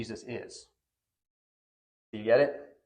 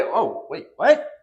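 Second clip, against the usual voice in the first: high, loud startled "what?" leaping up; mock surprise; 5 semitones higher, 5 dB louder, slower than usual. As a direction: play the Pharisees catching on in shock. Pharisees suddenly grasp the claim.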